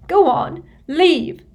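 high, loud tearful order; crying. A narrator's reading. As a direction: sob it out as a dismissal. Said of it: "now crying into the napkin".

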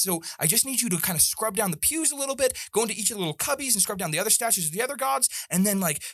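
high, bubbly, excited voice; eager young woman. Acted. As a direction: gush over the visitor eagerly.

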